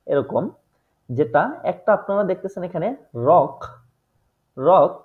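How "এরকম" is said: ordinary talk, nothing marked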